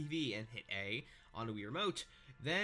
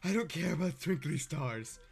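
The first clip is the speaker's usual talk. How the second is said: character voice; 4 semitones higher, 7 dB louder than usual. Acted. Voicing game character line.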